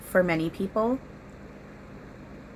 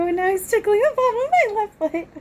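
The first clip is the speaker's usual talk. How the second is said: laughing; 8 dB louder than usual. Real, not acted. Being tickled, laughing.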